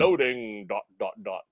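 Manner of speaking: lightly imitated ring announcer, falling away softly; WrestleMania announcer. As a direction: toss off a half-hearted wrestling announcer imitation. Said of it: "like, a WrestleMania type of voice".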